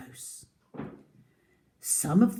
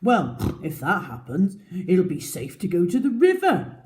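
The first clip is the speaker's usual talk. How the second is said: louder, gruff pig voice; grunting wild pig; 6 dB louder than usual. A narrator's reading. Wild pig hoping for the river, grunting. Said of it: "grunted a wild pig".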